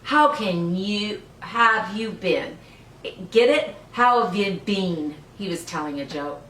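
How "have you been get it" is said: ordinary delivery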